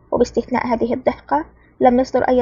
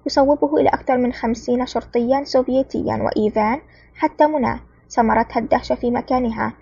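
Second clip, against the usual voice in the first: usual speaking voice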